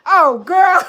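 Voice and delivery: high, loud amused cry; delighted exclamation. Real, not acted. Laughing at a friend's mix-up, delighted loud cry. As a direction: burst out with delight at the caller.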